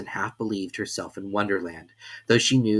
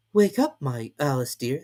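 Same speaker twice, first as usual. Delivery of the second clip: gentle sister; 4 semitones higher than usual. A narrator's reading. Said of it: "said her sister".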